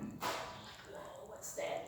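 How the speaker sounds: breathy whispered alarm at the light; hushed, awed whisper. Retold or imitated. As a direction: whisper it, spooked in the dark.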